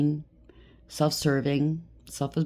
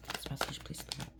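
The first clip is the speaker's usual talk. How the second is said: a breathy whispered plea to the spirit; 17 dB quieter than usual. Real, not acted.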